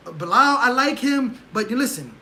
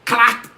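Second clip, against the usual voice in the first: loud smacking punch noise; a sharp clack like a knockout blow; punch sound; 8 dB louder than usual. Retold or imitated. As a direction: mouth a hard punch sound effect.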